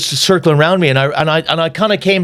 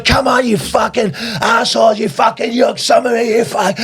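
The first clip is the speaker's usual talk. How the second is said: high mock shouting, insults tumbling out; retold charging yell; 6 semitones higher than usual. Storyteller replaying his run at the skinheads, shouting high. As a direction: yell it as if running into a fight. Acts out running at them yelling.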